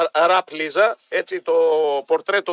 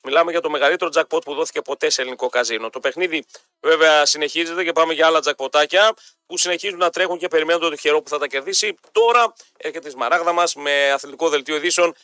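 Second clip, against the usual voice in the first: lower-pitched, steady promo delivery; radio ad read; 3 semitones lower than usual. Acted. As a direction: sell it like a live radio sponsor read. Host reads casino and shop ads.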